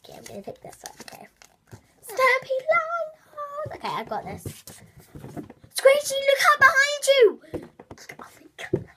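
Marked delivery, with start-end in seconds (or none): 2.0-3.8 high, loud, drawn-out squeal; over-the-top high-pitched gamer voice; high YouTuber impression. Impersonating YouTuber Stampy. 5.8-7.4 very high, loud squeal; hammed-up high-pitched gamer voice; squealing YouTuber impression. Impersonating YouTuber's catchphrase.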